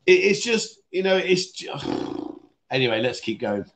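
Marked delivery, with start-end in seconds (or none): 1.7-2.3 long unvoiced exhale; heavy sigh. Real, not acted. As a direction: let out a helpless sigh. Feels sorry for frightened man.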